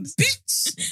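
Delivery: high, loud mocking snap; mock angry shout. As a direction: hurl it like a playful insult. Mock angry outburst over flowers.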